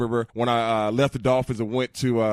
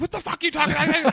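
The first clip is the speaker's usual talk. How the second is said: incredulous coach. Retold or imitated. Coach's incredulous reply, retold.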